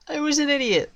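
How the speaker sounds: high-pitched self-reproach at normal volume; high lament. Real, not acted. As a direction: wail it in regret without shouting. Repeats self-blame less loudly.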